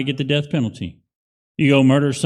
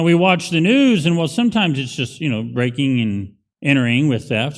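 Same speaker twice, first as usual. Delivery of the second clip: ordinary talk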